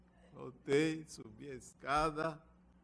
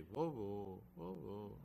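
very soft, mildly whimpered call; imitated tearful child; 4 semitones lower, 12 dB quieter, slower than usual. Grandson crying to his watching grandpa, softly imitated pleading. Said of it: "ele chorando".

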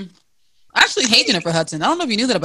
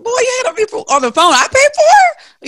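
high, loud scolding shout; angry scolding; 6 dB louder than usual. Retold or imitated. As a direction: scold him with outrage. Acting out scolding a broke partner.

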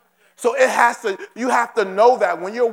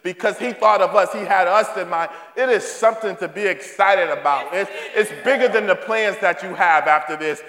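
ordinary talk

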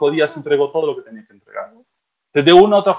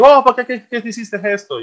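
scolding parent; 9 semitones higher than usual. Pretend. Imagined scolding of son.

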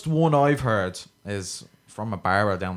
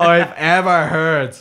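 mock South Dublin posh; 6 semitones higher, 7 dB louder, much slower than usual. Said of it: "most South Dublin".

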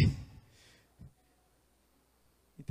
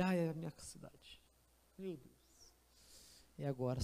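hushed dread; 6 semitones lower, 11 dB quieter than usual. Acted. His city arrives, dread.

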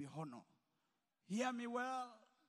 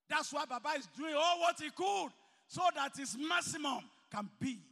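preaching at full force; 4 semitones higher, 10 dB louder than usual. Acted. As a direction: hammer each phrase out to the hall. Preacher climaxing on Yoruba proverb.

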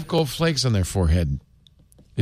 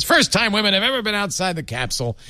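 a lightly voiced mock Trump, pitched higher; terrible Trump impression; 9 semitones higher, faster than usual. Mocking the president's spacewalk gaffe with a bad Trump impression. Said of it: "That was a terrible Trump".

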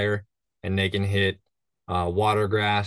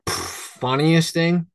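slow musing; 7 semitones higher, 3 dB louder, much slower than usual. Real, not acted. Guide musing on the question.

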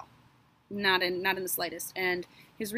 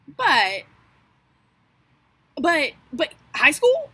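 raised incredulous but, but high school; 7 semitones higher, 10 dB louder than usual. Real, not acted. Host scoffing at Nicholas sent to high school, incredulous. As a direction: scoff at the idea in disbelief.

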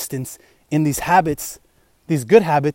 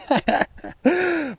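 a short high burst of laughter; 10 semitones higher, 4 dB quieter than usual. Real, not acted. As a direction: laugh at the awkward memory. Laughing at getting brushed off.

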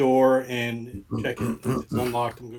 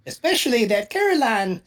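much higher, slow squeaky gnome voice; best Gnomish accent; 13 semitones higher, slower than usual. Acted. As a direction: pitch it way up like a tiny gnome. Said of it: "in my best Gnomish accent".

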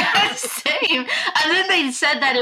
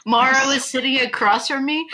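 normal talking voice

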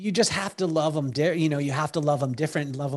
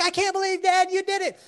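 put-on much higher, awestruck child; amazed son; 14 semitones higher than usual. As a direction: marvel at dad's success. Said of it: "He's like".

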